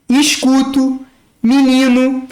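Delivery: Escuto as "iscutu", menino as "mininu"; Rio pronunciation. Shown as a demonstration. Teacher modeling Rio vowels: escuto, menino. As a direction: say e as i, Rio style. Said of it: "é como se a palavra fosse escrita com I".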